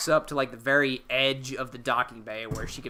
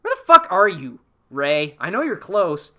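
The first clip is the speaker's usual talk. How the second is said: louder, higher mock frustration; frustrated Kylo; 4 semitones higher, 8 dB louder than usual. Podcaster voicing Kylo calling for Rey, loud mock frustration. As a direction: bark for her, then coax in a hammed-up way. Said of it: "And he's like".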